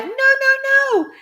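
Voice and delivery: too late to stop her; horrified cry. A narrator's reading. Parents watching grandma drink the medicine, panicked cries. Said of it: "the other two cried".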